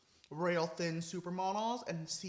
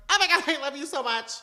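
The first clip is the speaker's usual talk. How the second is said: high, loud fangirl shriek; excited scream; 7 semitones higher, 10 dB louder than usual. Retold or imitated. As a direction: scream it like meeting your idol. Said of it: "I literally screamed".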